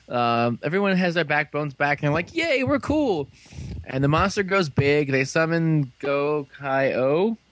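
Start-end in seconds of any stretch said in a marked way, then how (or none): 2.3-3.3 high, gleeful group cheer; mock cheer. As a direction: act out heroes celebrating themselves. Acted group cheer in retelling.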